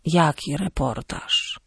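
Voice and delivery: put-on slow, soft old man's question; frail, bewildered grandfather; confused grandfather. A narrator's reading. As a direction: ask slowly and weakly, as if lost. Confused dying grandfather asks.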